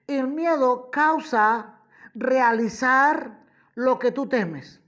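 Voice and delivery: ordinary talk, nothing marked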